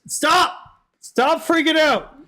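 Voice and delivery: shouting stop at a freaking-out avatar; exasperated yell. Real, not acted. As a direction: yell at the glitching model to stop. Yelling at glitching model.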